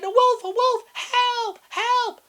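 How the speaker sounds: very high, loud terrified cries; screaming for help. A narrator's reading. Grandma attacked by wolf, screaming for help. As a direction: scream for help like a frail old lady.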